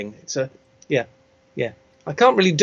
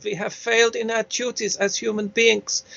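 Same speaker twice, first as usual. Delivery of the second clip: put-on higher, softer world-weary German accent; regretful war-film German, lightly hammed up; lazy German accent; 5 semitones higher, 5 dB quieter than usual. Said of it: "slightly lazy German accent".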